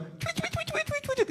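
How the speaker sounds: very high, soft bird chirps; imitated birdsong. Preacher showing a bird cheering up the sad, chirping. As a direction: chirp rapidly like a little bird. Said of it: "ada burung berkicau".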